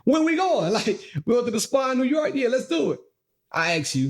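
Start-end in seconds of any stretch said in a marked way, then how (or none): 0.1-0.7 high-pitched, eager imitation of a keen wife; eager agreement. Husband imitating wife keen on the spa, eager and high. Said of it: "If it's something that she wants". 1.2-3.0 quick, high-pitched mock enthusiasm; eager agreement. Husband voicing wife planning spa trip, high and eager. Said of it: "If it's something that she wants".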